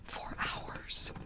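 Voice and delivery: hushed, slow spooky whisper. A narrator's reading. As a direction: let it fade into a whisper. Night of terror fading out.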